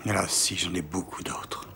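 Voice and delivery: slow, breathless whisper; barely voiced confession. Admitting debts, unvoiced and slow.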